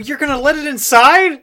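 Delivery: alarmed disbelief. Acted. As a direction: blurt it out in loud, high disbelief. Alarmed player reacts to opossum plan.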